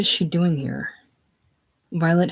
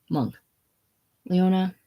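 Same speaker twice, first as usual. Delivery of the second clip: ordinary delivery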